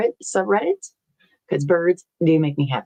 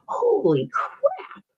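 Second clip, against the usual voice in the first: surprised; 12 semitones higher, much slower than usual. Real, not acted. Reacting to ticket price.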